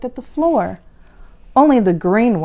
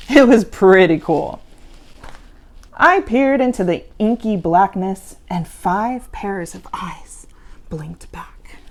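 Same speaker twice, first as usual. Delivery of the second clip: ordinary delivery, nothing marked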